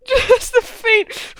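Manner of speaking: high, slow words squeezed through laughter; helpless giggling as you talk; laughing. Real, not acted. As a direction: barely get the words out for laughing. Breaks up laughing.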